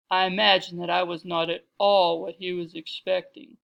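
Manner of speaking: loud, stately understatement; big, rounded, well-bred voice; deep, cultured voice. A narrator's reading. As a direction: speak as a grand refined matron. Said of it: "her deep, cultured voice".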